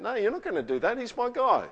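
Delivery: high, loud, firm objection; acted indignant defence; protective God. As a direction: step in hard to defend a close friend. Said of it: "He's going".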